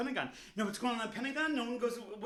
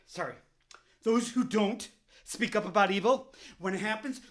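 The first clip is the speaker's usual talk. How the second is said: normal talking voice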